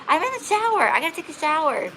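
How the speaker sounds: high-pitched, whiny mock protest; imitated stalling excuse. Woman on the phone putting off coming over, protesting.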